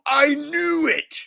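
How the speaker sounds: high, slow, loud I-knew-it shout; mock excited gullible fan. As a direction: play the believer vindicated at last. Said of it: "people are like".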